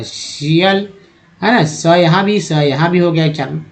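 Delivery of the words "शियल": शियल said as "shiyal" with a sh sound and a rising end; teacher drawing out शियल; tial ending. Shown as a demonstration. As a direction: stretch शियल as "shi-yal". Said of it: "टी आई से हो गया श".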